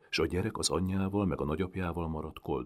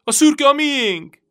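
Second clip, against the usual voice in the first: very high, loud tearful cry; crying shout; 17 dB louder, slower than usual. A narrator's reading. Boy crying out to the Turk for his horse. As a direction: call after the man through tears. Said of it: "kiáltotta sírva".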